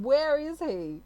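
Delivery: high, loud mock wail; exasperated demand. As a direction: call out for him, hammed up. Acting out the impatient woman again.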